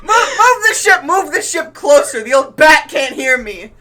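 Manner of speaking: high yell, easing down in pitch; loud, hammed-up villain's command; exasperated shouted order. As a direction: bellow at your crew in frustration. Villain orders crew, frustrated at shouting.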